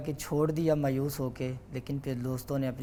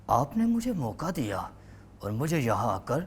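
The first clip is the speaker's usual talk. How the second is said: mentor's voice. Acted. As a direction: boom it out like a stage host. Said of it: "آپ نے جن سے سیکھا تھا ذرا ان کی آواز میں". (استاد کی آواز میں بلند، پُرجوش شکریہ)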